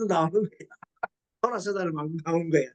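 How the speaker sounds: normal talking voice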